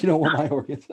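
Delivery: loud laugh bursting through the line. Real, not acted. Joking to his friend about stolen organs, laughing hard. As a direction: crack up mid-joke.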